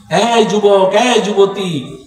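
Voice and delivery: normal talking voice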